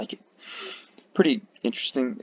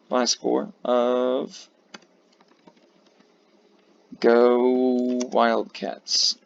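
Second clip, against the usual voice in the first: slow cheer; 3 semitones higher, much slower than usual. Pretend. Drawn-out sports cheer.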